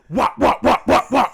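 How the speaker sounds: loud mouth gunshot sounds, wop wop; imitated gunshots. Retelling the bouncer firing his gun, loud mock gunshots. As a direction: bang out the shots with your mouth.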